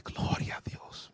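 slow whispered praise; inward whisper. Retold or imitated. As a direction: whisper it as a private thought. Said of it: "en mi corazón dije".

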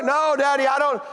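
put-on high, quick little-kid protest; little daughter. Young daughter cutting in to deny it, child voice. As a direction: cut in like a small child. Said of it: "She's four or five years old".

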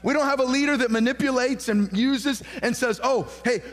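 preacher aping a sly leader, lightly; mocking manipulator within preaching. Real, not acted. Pastor mocking a manipulative king, light mock. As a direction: toss off the bribe quick and sly.

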